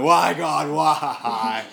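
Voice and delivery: hammed-up anguished cry; mock wail to God, groaning on the floor. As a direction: wail it to the heavens in mock despair. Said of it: "on the floor groaning, slapping the floor going".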